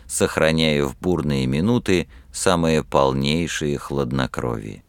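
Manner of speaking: ordinary delivery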